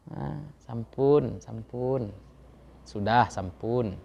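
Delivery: sampun stretched very slowly, fading; slow polite form. Shown as a demonstration. Teacher repeats krama for students, very slow and deliberate. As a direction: savour sampun as a refined word. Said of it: "Kalau dengan orang tua".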